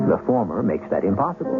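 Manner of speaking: usual speaking voice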